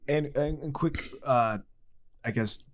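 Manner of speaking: hoarse from being sick; groggy voice. Real, not acted. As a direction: speak through a sick, groggy throat. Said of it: "I have this groggy voice".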